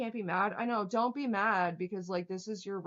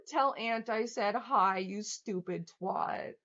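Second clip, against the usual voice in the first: usual speaking voice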